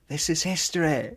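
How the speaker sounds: a light, laughing Mancunian rock-star swagger. Retold or imitated. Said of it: "Oasis walking out i-, into Knebworth".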